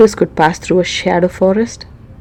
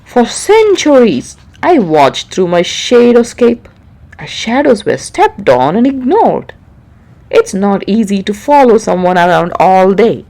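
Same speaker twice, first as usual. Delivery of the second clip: frowning king; 5 dB louder than usual. Acted. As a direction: lay out the grievance like a displeased king. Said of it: "The king frowned".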